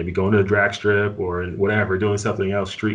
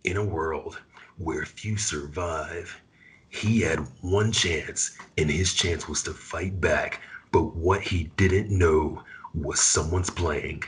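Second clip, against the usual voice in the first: deep, slow, dramatic trailer narrator; movie trailer voice; 3 semitones lower, 4 dB quieter, much slower than usual. Shown as a demonstration. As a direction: growl it out like a scary movie preview, slowly. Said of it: "I can do, like, a trailer voice".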